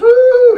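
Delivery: high, loud excited whoop; hammed-up whoop. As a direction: let out a wild whoop. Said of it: "He gets all excited".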